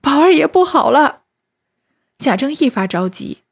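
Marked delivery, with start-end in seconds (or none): 0.0-1.2 high, louder cry of alarm. A narrator's reading. Maid rushing out to the master, crying out in alarm. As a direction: wail the bad news from inside. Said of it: "里头又哭出来说".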